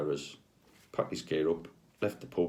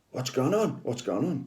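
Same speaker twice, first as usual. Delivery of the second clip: alarmed; 8 semitones higher than usual. Retold or imitated. Hand goes into spasm.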